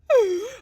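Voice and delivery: high, loud theatrical gasp; shrill hammed-up gasp; mock gasp. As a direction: gasp like a shocked victim. Mocking panicked people.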